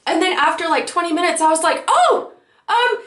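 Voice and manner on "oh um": high-pitched, sudden happy realization; re-enacted thrilled acceptance; so excited. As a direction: blurt it out high and giddy. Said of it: "I was so excited about the fact".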